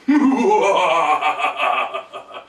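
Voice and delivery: evil mustache-twirling cackle; villain laugh. Acted. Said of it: "That's my villain laugh".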